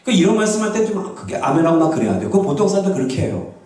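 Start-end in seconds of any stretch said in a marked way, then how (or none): none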